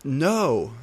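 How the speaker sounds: loud, high scream of horror. A narrator's reading. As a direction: cry out in shock. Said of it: "I screamed".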